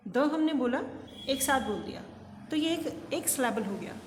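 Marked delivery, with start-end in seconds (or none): none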